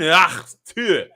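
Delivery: "Ach, tu" hawked from the throat in a mock German accent. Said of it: "Would you like my German?".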